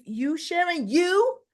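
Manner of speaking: high, loud incredulous question; appalled disbelief. Retold or imitated. Phyllis asks if Sharon approached her grieving family, appalled.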